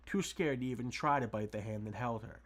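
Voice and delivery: usual speaking voice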